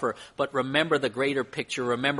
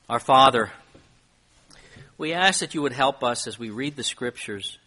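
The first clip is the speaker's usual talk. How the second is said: earnest, louder pastoral prayer; closing prayer; 3 dB louder than usual. Acted. Pastor prays for the room after the sermon, earnest. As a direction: pray over the congregation with conviction.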